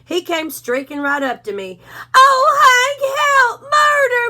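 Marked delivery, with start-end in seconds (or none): 2.1-4.3 much higher, louder, crying panic; a terrified little dog yelping for help; panicked Drover. A narrator's reading. Drover panics, held high voice.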